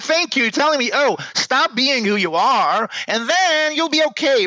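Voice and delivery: high, biting mock gratitude; sarcastic thanks. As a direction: throw their advice back at them, bitterly. Sarcastic retort to the advisers.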